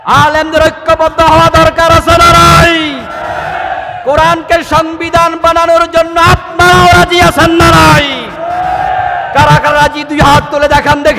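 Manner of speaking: high, very loud rallying sermon; full-force preaching. Real, not acted. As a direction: shout to rouse a whole crowd. (জোর গলায় উত্তেজিত ওয়াজ)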